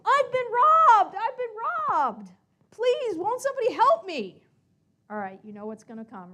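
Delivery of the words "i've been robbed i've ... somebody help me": shouting. Acted. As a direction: yell for the whole street to hear. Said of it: "he ran out of the street shouting".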